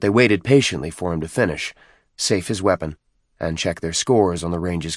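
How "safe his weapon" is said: plain reading voice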